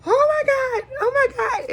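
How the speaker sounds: very high, squealing "oh my God" twice; embarrassed squeal. Retold or imitated. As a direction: squeal like a starstruck fan caught out. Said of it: "I was like".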